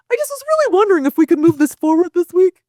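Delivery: high, timid pleading nerd; nerd impersonation. Retold or imitated. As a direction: play it like a meek nerd asking permission. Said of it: "impersonation I did of a nerd".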